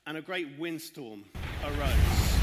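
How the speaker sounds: slow, loud storm announcement. Acted. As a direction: boom it out as the storm hits. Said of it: "it's gonna get very loud".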